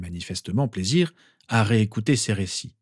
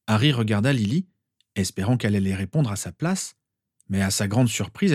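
ordinary delivery, nothing marked

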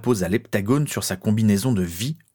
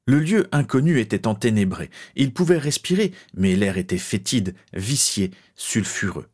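ordinary delivery

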